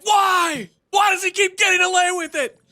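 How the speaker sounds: high, loud whining wail of protest; mock crying. Streamer imitating jealous doubters, loud mock crying. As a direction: imitate sore losers bawling in outrage. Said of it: "They're crying".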